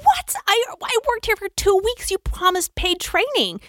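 high, disbelieving protest; high pitched indignant what; outraged disbelief. Retold or imitated. Arguing with boss over pay.

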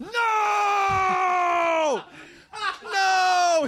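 high laughing shout of disbelief; outraged delighted protest; shocked no. Real, not acted. Reacting to the mispronunciation story.

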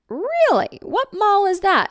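very high, eager questions; eager interest. Retold or imitated. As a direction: fake breathless enthusiasm. Eager about talent scout.

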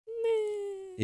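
unimpressed meh. Retold or imitated. Judge's unimpressed reaction.

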